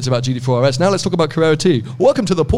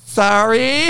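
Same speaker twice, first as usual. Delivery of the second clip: baffled server; 7 semitones higher than usual. Retold or imitated. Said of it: "you can imagine she was like".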